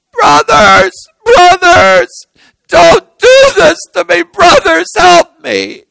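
anguished high-pitched wailing shout; crying out. Acted. Joseph crying out to brothers while led away, desperate wail. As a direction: wail it after them, desperate. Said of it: "he crying out".

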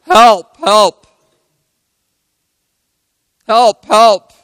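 high, loud desperate yell for help; crying out for help. Retold or imitated. Man dangling off a cliff yells for help, loud and high. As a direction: shout help from the cliff edge. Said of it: "And he cries out".

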